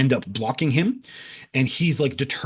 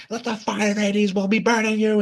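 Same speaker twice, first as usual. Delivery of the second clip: high, strained mock scream; Marcus screaming; 9 semitones higher than usual. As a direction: imitate screaming a curse without full volume. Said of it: "he's screaming at them".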